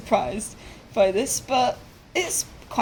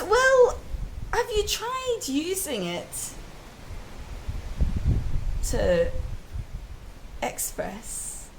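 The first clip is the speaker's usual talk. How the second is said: gentle toucan; 8 semitones higher, 3 dB quieter, slower than usual. Acted. Wise toucan gives gentle advice.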